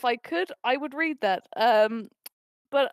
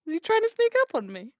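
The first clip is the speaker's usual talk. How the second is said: higher-pitched suspicious question; mock suspicion; 4 semitones higher, 3 dB louder than usual. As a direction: raise the pitch in mock alarm. Repeated question, building to higher take.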